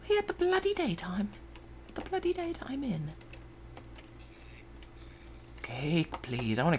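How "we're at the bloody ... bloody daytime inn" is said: silly high-pitched put-on voice; playful high voice. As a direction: pipe it up high for fun. Playful high voice about daytime.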